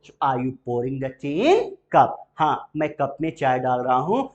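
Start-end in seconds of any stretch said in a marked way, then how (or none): 0.1-2.2 very slow, alms without the L; slow model question. Shown as a demonstration. Teacher models the question and silent L, very slow. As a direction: spell it out slowly. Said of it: "बोलते चलिएगा".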